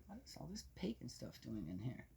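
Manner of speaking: ordinary delivery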